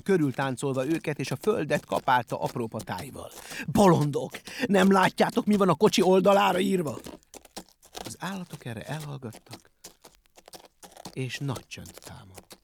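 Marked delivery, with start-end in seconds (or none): 3.7-7.0 raised, loud scolding; high, forceful outburst; exasperated yelling. A narrator's reading. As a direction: berate them for their blindness. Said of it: "üvöltötte Benyámin".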